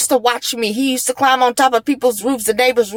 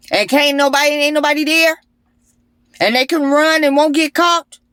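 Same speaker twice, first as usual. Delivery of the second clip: ordinary talk, nothing marked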